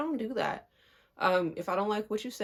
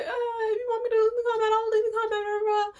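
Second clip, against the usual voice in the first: very high, rushed mimicry; mocking whine; 13 semitones higher, faster than usual. Mimicking the cousin's offer to drop it, mocking whine. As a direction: squeal it through as meaningless blah.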